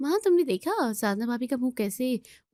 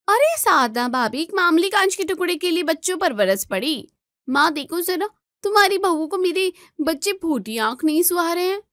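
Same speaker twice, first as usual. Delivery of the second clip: indignant accusation; 7 semitones higher, 4 dB louder than usual. Acted. Neelam accusing sister-in-law to mother.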